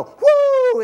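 a huge high, loud whoop; mock ecstatic whoop. Preacher mocking born-again euphoria with a high loud whoop. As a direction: whoop like you're floating away.

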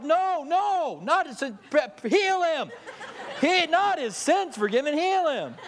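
high-pitched, comic correcting plea; mock frantic protest. Friend on roof corrects Jesus's prayer, comically urgent protest. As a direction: call down in exasperated objection.

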